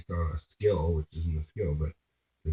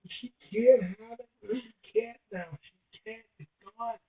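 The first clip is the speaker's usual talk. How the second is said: high, whimpering mock sob; imitating the crying girlfriend, high mock wail; mock bawling. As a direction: imitate her crying, pitched up and wailing. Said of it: "she's bawling".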